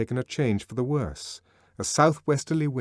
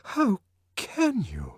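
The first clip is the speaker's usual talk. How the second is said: high, slow, shocked plea; amazed distress; 11 semitones higher, slower than usual. A narrator's reading. Lenina shocked at Bernard, high amazed distress. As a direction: gasp it out, shocked and hurt. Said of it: "in a voice of amazed distress".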